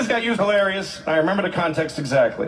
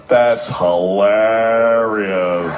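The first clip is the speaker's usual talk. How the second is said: "That's hilarious" dragged out slow and flat; deadpan drawl; 11 semitones lower, 5 dB louder, much slower than usual. Retold or imitated. Imitating the friend's bored, flat reply. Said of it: "And he goes".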